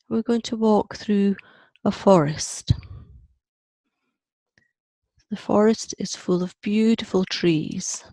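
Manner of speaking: measured meditation voice with long pauses; guided visualization. Acted. Teacher walking a group through an imagined forest, guided meditation. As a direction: lead them gently along, pausing after each image.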